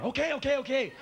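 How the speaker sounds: high, hurried mock-frightened okays; scared giving in. Man giving in to his angry girlfriend, mock scared. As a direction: cave in fast like a scared man.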